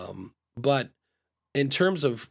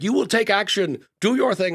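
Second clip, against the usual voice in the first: higher, louder barked order; director's shout; 8 semitones higher, 6 dB louder than usual. Retold or imitated. Imitating a film director shouting orders on set. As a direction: bark it at the actors from the director's chair. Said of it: "action is sometimes shouted at you from a director".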